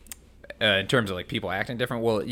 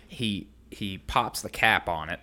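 ordinary talk, nothing marked

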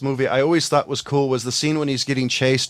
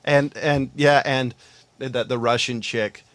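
ordinary talk

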